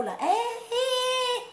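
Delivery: long drawn high wailing cry; emotional wail. Real, not acted. Woman overcome while speaking of her Congo, a slow high wail. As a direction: let the feeling pour out.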